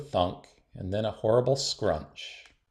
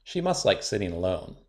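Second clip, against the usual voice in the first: ordinary delivery, nothing marked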